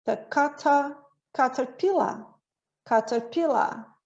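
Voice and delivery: caterpillar in a British accent, no R at the end; British caterpillar, final R dropped. Shown as a demonstration. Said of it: "E no inglês, ah, britânico, ele faz".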